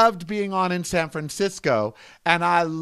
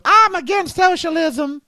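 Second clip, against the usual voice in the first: high, mock-indignant protest; scoffing impression of a self-righteous critic; mocking a pundit; 10 semitones higher, 3 dB louder than usual. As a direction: parrot them in a raised, scornful pitch. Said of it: "Are you the same one screaming".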